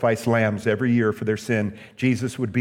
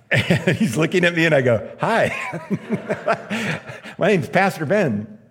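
high, laughing awkward greeting; 6 semitones higher than usual. Real, not acted. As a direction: tell it through real laughter. Laughs while retelling his startled greeting.

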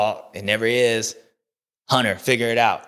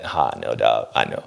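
much lower, laid-back mock voice of Jay; imitating Jay. Jay brushing off his friend's offer, low and relaxed. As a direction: drop way down and brush it off easily. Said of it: "Jay's be like".

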